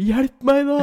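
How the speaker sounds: very high, loud cry for help; sick partner crying out; desperate plea. Retold or imitated. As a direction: beg in pain. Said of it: "hun liksom bare".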